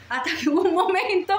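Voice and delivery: ordinary talk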